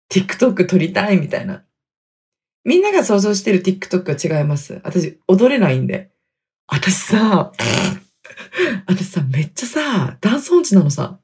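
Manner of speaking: ordinary delivery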